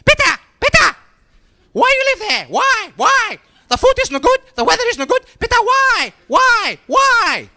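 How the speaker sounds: very high, loud wailing mum voice; Greek mum. Acted. Greek mother phoning her son abroad, shrieking in disbelief. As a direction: screech it as an exasperated Greek mother, heavy accent. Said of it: "I get these calls from my mum".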